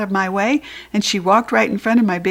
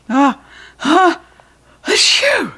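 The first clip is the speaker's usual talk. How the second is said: rising, louder mock sneeze; sneeze build-up; 9 semitones higher, 7 dB louder, slower than usual. A narrator's reading. Judy B sneezing into the fluffy towel.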